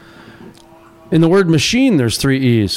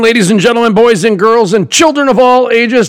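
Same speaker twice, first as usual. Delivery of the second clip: booming big top ringmaster welcome; 7 semitones higher, 5 dB louder than usual. Acted. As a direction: announce it like a circus ringmaster. Circus style show opening welcome.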